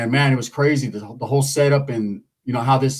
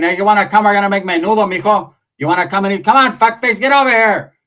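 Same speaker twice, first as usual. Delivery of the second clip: higher, louder fatherly hollering; imitating the dad; 5 semitones higher, 4 dB louder than usual. Dad calling his son over for menudo, loud. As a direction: call across like a dad inviting you over. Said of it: "My dad keeps saying".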